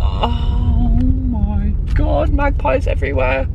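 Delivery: high, drawn-out amazement; amazed. Real, not acted. Driver spotting magpies everywhere at the lake, amazed. Arriving at spontaneous detour, amazed.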